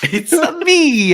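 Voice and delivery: high, slow Mario impression; hammed-up "It's a me" Mario.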